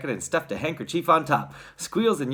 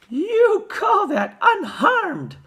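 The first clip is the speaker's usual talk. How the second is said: very high, loud, drawn-out outrage; outraged mother's shrill question; snapped; 8 dB louder, much slower than usual. A narrator's reading. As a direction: throw it back indignantly, stretching the words. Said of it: "snapped Missus Teavee".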